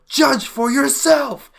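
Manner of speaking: high, loud burst; shouted line in a review read; all-caps yell. Shown as a demonstration. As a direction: yell it like capital letters. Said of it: "yell when we do it".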